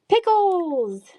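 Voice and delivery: high, excited calling out. Real, not acted. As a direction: announce the winner with glee. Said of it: "That goes to Pickles".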